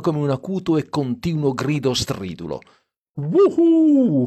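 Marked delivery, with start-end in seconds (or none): 3.1-4.3 high, loud drawn-out "woohoo"; whoop. A narrator's reading. Dean whooping in the car at night, high loud whoop. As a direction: let out a soaring whoop. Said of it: "fece Dean".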